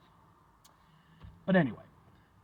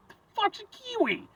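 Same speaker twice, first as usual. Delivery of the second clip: put-on high, puzzled childish squeak; baffled kid; 7 dB quieter than usual. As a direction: act out a confused fourth grader. Kid self confused by kiwi.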